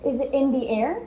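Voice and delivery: put-on bright, upbeat coaching of little kids; teacher voice to kids. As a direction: cheer them on like a speech therapist. Therapist coaching young kids.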